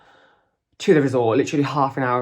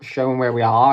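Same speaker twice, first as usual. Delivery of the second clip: ordinary delivery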